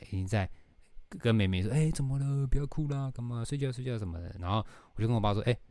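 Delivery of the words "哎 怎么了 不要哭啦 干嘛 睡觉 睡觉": put-on softer, hushed coaxing of a crying baby; soothing dad voice. Dad coaxing the crying baby sister back to sleep, hushed and soft. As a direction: lull her back to sleep softly.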